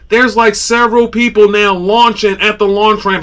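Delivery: ordinary talk, nothing marked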